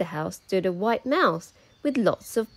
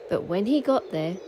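ordinary delivery

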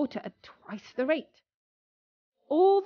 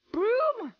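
high sharp call; 12 semitones higher than usual. Acted. Apprentice shouts after the disobedient broom, high call.

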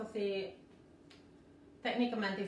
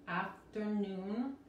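"Afternoon" drawn out slowly, syllable by syllable, R untrilled; slow syllable demo; much slower than usual. Shown as a demonstration. Teacher modelling for Spanish speakers, slow "Afternoon" with untrilled R. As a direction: break "Afternoon" into slow, separate parts. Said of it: "la R no se vibra".

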